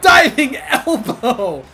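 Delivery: loud stretched shout broken by a laugh; laughing move call. Real, not acted. Gamer shouting the move mid-laugh, loud and slow. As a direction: yell it while cracking up. Calling wrestling moves while playing.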